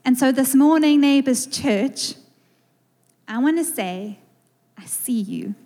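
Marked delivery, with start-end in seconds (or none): 4.8-5.6 soft, low, near-whispered; hushed, heartfelt greeting; tender greeting. Real, not acted. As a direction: say it gently and intimately to the room.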